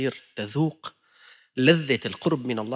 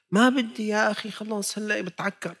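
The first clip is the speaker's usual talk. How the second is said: mock refusal; 9 semitones higher than usual. Worshipper refusing to eat.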